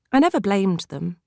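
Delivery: ordinary delivery, nothing marked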